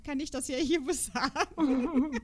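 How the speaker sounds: slow words broken by a laugh; chuckling while saying it. Real, not acted. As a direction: laugh through the end of the line. Laughs mid-sentence.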